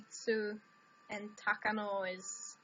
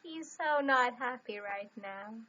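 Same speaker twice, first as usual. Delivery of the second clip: ordinary talk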